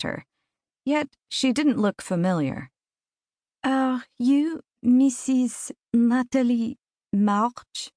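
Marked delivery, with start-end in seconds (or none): 3.6-7.9 put-on slow, soft-spoken Russian accent, guttural consonants; a shy stranger's Russian-accented question, said slowly. A narrator's reading. Shy Russian visitor asks the doctor her name, in a slow Russian accent. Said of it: "A Russian accent".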